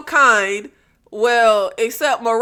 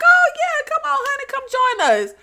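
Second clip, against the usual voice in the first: high, sing-song come-on; the prim woman suddenly gushing, voiced high; eager invitation; 12 semitones higher than usual. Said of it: "Miss Conservative, was like".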